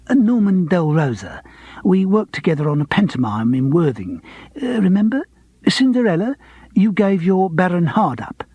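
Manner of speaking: a hint of a fussy old pianist pressing; eager old pianist jogging memory; eager actor. A narrator's reading. Norman reminding Charles eagerly.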